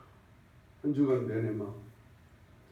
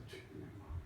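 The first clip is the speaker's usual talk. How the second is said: whispered; 22 dB quieter, faster than usual. Real, not acted. Sermon closing, hushed.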